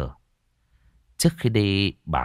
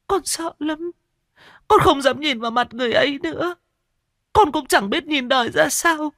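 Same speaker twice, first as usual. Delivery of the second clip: put-on high, frightened girl's voice; trembling. Diệp tells Mị what she saw, high and trembling. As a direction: shake as you relive what you saw. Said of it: "Cô Diệp run run".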